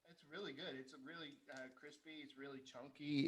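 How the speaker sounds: talking while chewing; words round a bite of food; mouth full. Real, not acted. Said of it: "Still chewing".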